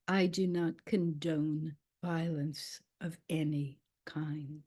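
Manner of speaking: low, soft, deep voice of a revered teacher; Dalai Lama. Retold or imitated. Speaker retells the Dalai Lama's answer to the furious woman, deep voice. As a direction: imitate a deep voice, slowly measured and quiet. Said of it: "he said in this voice, he has a very deep voice".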